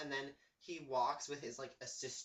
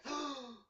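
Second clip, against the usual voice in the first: sharp acted gasp; 9 semitones higher than usual. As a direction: gasp in mock shock. Acts out Darla's shocked reaction.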